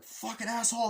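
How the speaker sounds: high-pitched mock fury; acted angry curse. Shooter curses his enemy, angry high cry. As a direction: spit the insult mid-gunfight, pitched high.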